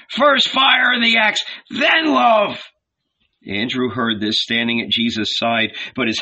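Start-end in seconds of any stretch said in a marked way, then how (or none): 0.1-2.6 high, forceful crowd cry; yelled. A narrator's reading. The hungry poor demand fire first, yelled. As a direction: yell it as a rallying cry. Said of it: "they yelled".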